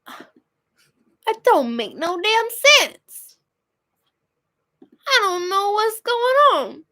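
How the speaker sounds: high, loud, wailing confusion; exasperated bafflement. Real, not acted. Host lost in the meeting software, exasperated. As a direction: protest loudly in a high, drawn-out wail.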